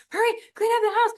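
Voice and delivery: high, loud, fast frantic order; frantic mock panic. Mom freaking out before dad comes home, frantic and fast. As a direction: rush them in a mock panic. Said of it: "mom starts freaking out".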